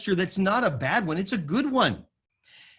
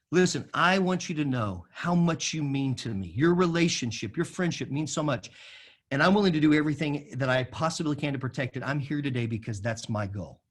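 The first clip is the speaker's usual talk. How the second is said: low, earnest reassurance; gentle affirmation; 4 semitones lower than usual. Shown as a demonstration. Pastor showing how to open a hard talk, lovingly affirming. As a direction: speak tenderly, voice settling lower. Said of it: "If that's the way you enter".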